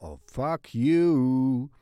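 slow, loud, drawn-out curse at the machine; real exasperation, loud and dragged; frustrated curse. Real, not acted. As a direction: let the annoyance out loud and long. Cursing the glitching computer.

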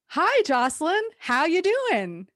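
high, loud fake-friendly hello; fake cheery greeting. HR boss greeting Jocelyn, high fake-cheery voice. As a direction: sing out a falsely cheery hi. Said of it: "and we're just like".

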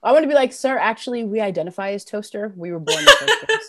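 high, loud laughing joke. Real, not acted. Band member joking about toaster image, laughing high. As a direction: crack up while riffing.

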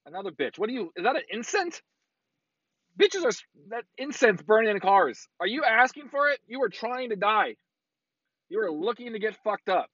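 high, incredulous rant at another driver. Real, not acted. Driver ranting at a woman burning incense, high rant. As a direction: scold her like she's crazy.

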